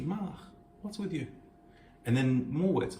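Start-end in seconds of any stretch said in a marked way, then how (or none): 0.0-1.3 curious. Acted. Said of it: "So there's a curiosity".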